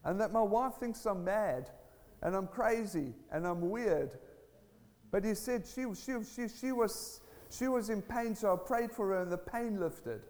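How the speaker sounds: high-pitched, emphatic testimony; impassioned preaching. Real, not acted. Telling church about an atheist proclaiming Jesus, impassioned preaching.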